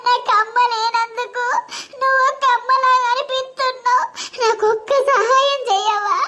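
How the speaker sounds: high, slow, crying voice; sobbing plea. Acted. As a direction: weep and beg her to help you escape. Bride sobs and pleads.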